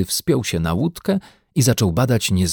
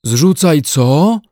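high, loud, slow question; drawn-out puzzled yell; shouting over noise; 6 semitones higher, 7 dB louder, much slower than usual. A narrator's reading. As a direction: shout it over splashing water. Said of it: "zawołał niedźwiadek, przekrzykując plusk".

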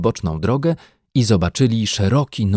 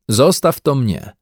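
higher call ending on the name; raised, lively calling voice; 8 semitones higher, 5 dB louder than usual. A narrator's reading. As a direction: call the bear over. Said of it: "zawołał".

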